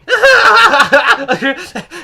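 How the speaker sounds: loud, high hammed-up cackle; mock crazy laughing. Crazy lady laughing at the cashier, manic cackle. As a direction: burst into a manic imitated laugh. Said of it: "And she starts laughing".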